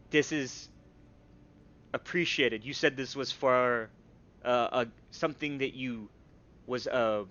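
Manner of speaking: normal talking voice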